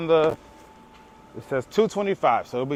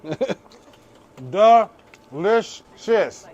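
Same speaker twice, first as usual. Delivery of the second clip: savoring; 3 semitones higher, 4 dB louder, much slower than usual. Real, not acted. Tasting the taco on camera.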